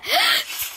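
high, sharp gasp; startled gasp. Real, not acted. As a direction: gasp in sudden surprise. Startled mid-threat in game.

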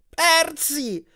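high, urgent cry. A narrator's reading. Grover tied to the bed calls his friend, crying out. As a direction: call the name out high. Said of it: "gridò Grover".

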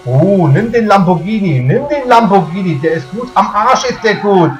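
loud sarcastic rage; berserk rage. Real, not acted. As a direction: yell it, then scoff. Mocking the Lamborghini advice.

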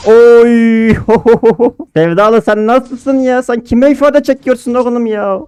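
high, loud teasing voice; sing-song mocking of the enemy player; mock taunt. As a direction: needle the opponent with a cartoonish jeer. Taunting opponent in game.

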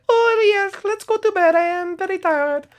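very high sleepy little character voice; Sing Song voice. Acted. Sing Song agreeing to go to bed, very high squeaky voice. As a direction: squeak it like a tiny sleepy camper.

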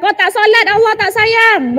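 mock threatening reprimand; acted parental scolding. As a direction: scold like a stern elder, stretching the ending. Voices elders scolding a child.

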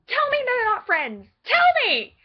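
high, loud fangirl outburst; high-pitched dramatic challenge; impassioned demand. Acted. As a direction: demand it, rising to a shout. Mock-impassioned challenge to the viewer.

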